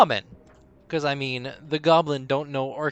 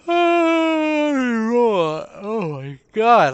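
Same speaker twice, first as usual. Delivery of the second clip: long stretched high gasp; drawn-out amazed exclamation; surprised; 10 semitones higher, 9 dB louder, much slower than usual. Real, not acted. Reacts to roll.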